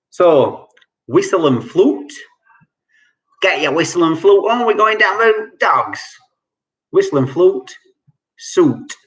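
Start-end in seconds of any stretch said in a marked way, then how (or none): 3.4-6.0 quick, lightly put-on Cockney; Cockney accent. Shown as a demonstration. Quizmaster demonstrating slang for the quiz, light Cockney accent. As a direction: rattle it off like a London geezer. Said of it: "I will try and do a Cockney accent for a moment".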